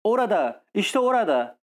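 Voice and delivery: crew shouting. A narrator's reading. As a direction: point and shout it out together. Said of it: "tayfalar bir ağızdan bağırmaya başladılar". (tayfaların yüksek perdeden coşkulu bağırışı)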